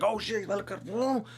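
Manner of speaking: high, slow alarmed "oh shit"; startled older dudes. Retold or imitated. Older dudes spotting the cop car, high and drawn out in alarm. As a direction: spot the cop car and freeze.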